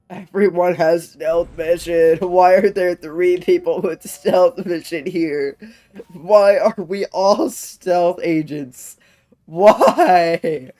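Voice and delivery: loud, slow, incredulous yelling; loud laughing disbelief, the last Why yelled. Real, not acted. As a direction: spell out the disbelief loudly, laughing.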